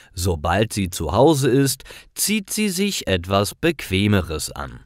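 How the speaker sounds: ordinary talk, nothing marked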